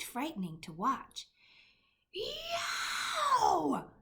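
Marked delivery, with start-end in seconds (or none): 2.1-3.9 high, loud anguished yowl; roaring giant. A narrator's reading. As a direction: howl out in pain from the nightmare. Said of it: "roared the flesh lump eater".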